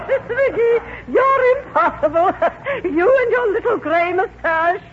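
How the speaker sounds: high, laughing society matron; amused exasperation. Acted. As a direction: laugh helplessly through the scolding. Dowager laughing at McGee's mustache joke.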